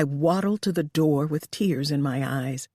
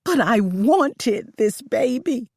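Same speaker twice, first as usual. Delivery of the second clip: slow, louder, hurt plea; crying out; 7 semitones higher, 5 dB louder, slower than usual. Acted. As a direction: cry out the loss. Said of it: "I cried".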